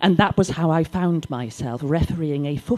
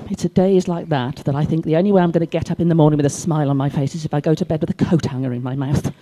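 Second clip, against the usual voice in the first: ordinary delivery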